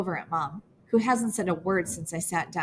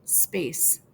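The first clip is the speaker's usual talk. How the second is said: hollow echo; 3 semitones lower, 4 dB quieter than usual. A narrator's reading. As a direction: Space said softly, flat and falling. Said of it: "having a little space".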